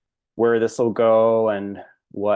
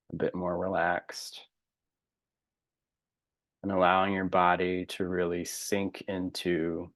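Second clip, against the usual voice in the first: spacious, calming breathing guidance, phrases trailing softer; guided meditation; 3 dB quieter than usual. Real, not acted. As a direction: guide them gently into relaxation, unhurried.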